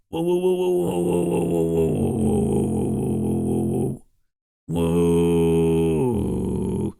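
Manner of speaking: loud rattling throat bass; vibration bass. Shown as a demonstration. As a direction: buzz the throat hard underneath. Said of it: "vibration bass".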